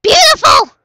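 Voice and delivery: squealed beautiful; delighted scream. Real, not acted. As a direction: scream it in awe at the view.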